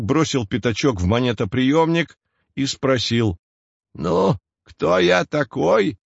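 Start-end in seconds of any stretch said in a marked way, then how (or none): 3.9-6.0 put-on old man voice. Disguised boy as old man.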